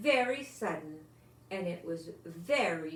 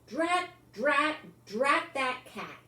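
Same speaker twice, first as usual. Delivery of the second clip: higher, louder cross grumble at the cat; annoyed drat; 6 semitones higher, 4 dB louder than usual. A narrator's reading. Parents cursing the cat at night, higher and louder. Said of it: "Mister and Missus Thomas said".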